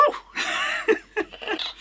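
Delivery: squeaky breathy laugh; breathless high laugh. Real, not acted. Gecko struggle.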